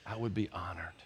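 bow your head as you say it; honored reverence. Retold or imitated. Pastor answering God humbly, hushed reverence. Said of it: "I bowed and I said".

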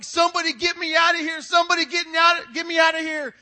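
high, very loud frantic yell; panicked shouting. Acted. Man in gorilla suit trapped with huge lion, panicked shouting. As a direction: scream for rescue in hammed-up terror. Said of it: "He starts freaking out".

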